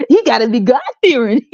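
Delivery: high prissy mock voice; mocking woman's answer. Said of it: "Here go the woman".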